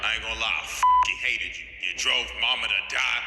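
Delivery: low, scornful villain voice; sneering at the grave, pitched low; mock Dio sneer. Dio insulting dead father at grave.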